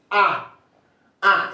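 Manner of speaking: high, emphatic nasal "Ah"; French nasal EN. Shown as a demonstration. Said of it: "pronounce this E N as like this".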